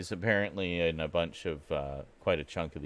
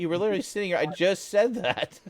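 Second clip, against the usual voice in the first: laughing; 13 semitones higher, 8 dB louder than usual. Real, not acted. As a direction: laugh through the words. Laughing protest at co-host.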